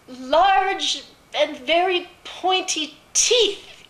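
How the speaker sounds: high, loud, very slow amazement; astonished girl. A narrator's reading. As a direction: stretch each word high in growing alarm. Girl sees the wolf's teeth.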